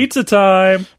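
co-host cheers the pizza catchphrase; excited callout. Pretend. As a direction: call it out like a catchphrase.

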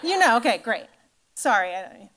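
ordinary talk

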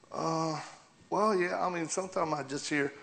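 lightly voiced child owning up; child confiding. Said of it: "when your kids tell you".

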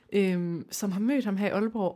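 ordinary delivery

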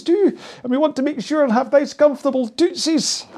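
a jokey high Scottish granny lilt; Mrs Doubtfire Scottish. Pretend. Rowing coach slipping into a high Scottish nanny voice. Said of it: "suddenly gone very Scottish".

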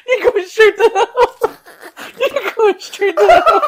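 high, loud words squeezed out through laughter; helpless laughter. Real, not acted. Telling the cohost he is doomed, helplessly laughing. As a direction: slowly force it out while cracking up.

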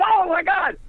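high, startled mock exclamation; a roomful of stunned onlookers crying out; shocked cry. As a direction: gasp it out like you just saw an ashtray hit the wall. Ashtray thrown, everyone gasps.

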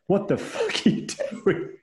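high, slow mock disbelief; imitating baffled disbelief. Wife catching husband stretching by candlelight, imitated baffled disbelief.

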